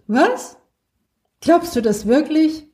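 high, startled Was? then a doubting question; surprised disbelief. Acted. Friend hears Luna could become human, astonished. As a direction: jump up in pitch, not believing it. Friend reacts to rebirth news.

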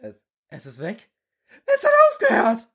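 high, stammering fear fading to relief; a scared boy stammering under fire; frightened recruit. Acted. Recruit stammering to the others in the bunker, high and fearful.

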